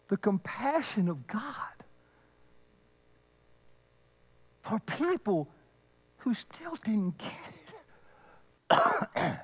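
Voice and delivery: quiet compassionate hush; hushed tender. Real, not acted. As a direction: lean in and say it gently. Tender hushed climax of sermon.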